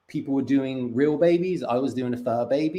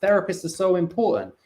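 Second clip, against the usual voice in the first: mocking trendy voice; 6 semitones higher, 4 dB louder than usual. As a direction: lightly parody people praising therapy. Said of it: "to be like".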